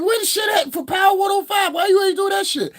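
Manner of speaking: yelling at the screen; high mock yell; retold shout. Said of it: "I was like".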